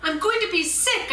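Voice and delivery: dizzy panicked screaming plea; screamed. Acted. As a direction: scream as the wheel spins you. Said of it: "I screamed".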